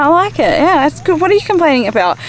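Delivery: high bright fake reassurance; fake cheerful. Vlogger pretending to her partner that the food was fine. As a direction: act like you love it though you don't. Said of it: "I was like".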